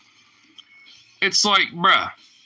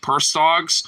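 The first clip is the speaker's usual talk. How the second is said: usual speaking voice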